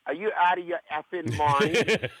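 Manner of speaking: imitating a brash Yonkers accent. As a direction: scold in a thick Yonkers, New York accent. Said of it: "she's got this accent".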